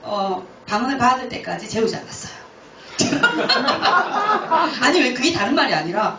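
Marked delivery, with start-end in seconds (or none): 2.9-4.8 a burst of high laughter. Real, not acted. Admitting the kids weren't allowed to sleep, laughing high. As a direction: laugh out loud at your own confession.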